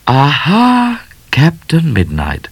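high, drawn-out triumphant "aha"; theatrical Miss Laura. Acted. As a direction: swoop up grandly, then settle. Miss Laura voiced grandly.

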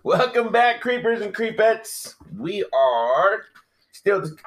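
high, drawn-out welcome; hammed-up host intro. Host welcoming listeners back to the show, hammed-up host intro. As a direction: stretch the show title like a spooky announcer.

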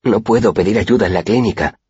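high, worried insistence; anxious. A narrator's reading. Student explaining to Mola why the clinic won't do, anxious. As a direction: let anxiety show in the voice. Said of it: "Dejé que mi ansiedad se reflejara en mi voz".

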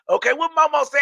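ordinary delivery

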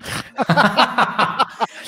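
high, hearty burst of laughing; burst of laughter. Real, not acted. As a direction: crack up laughing. Laughs at the retold quote.

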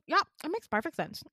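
high, quick imitated clerk; breezy insistence. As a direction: toss it back fast and high. Receptionist breezily insisting, acted out.

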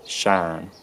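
"shan" as Southern US "shine", one long "ah" vowel without glide; Southern US monophthong. Shown as a demonstration. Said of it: "it's totally monophthongized again".

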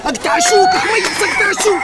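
high urgent shout of an order. Acted.

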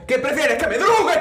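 half-joking outburst at his mother; mock defiant retort. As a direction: throw it back at mom like a bratty teen. Arguing back at his mother.

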